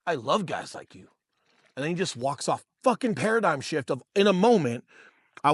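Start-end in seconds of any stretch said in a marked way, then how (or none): 0.1-1.1 kneel and say it softly, man to man; quiet encouragement. Retold or imitated. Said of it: "he fucking kneels down and he's like".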